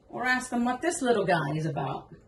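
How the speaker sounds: normal talking voice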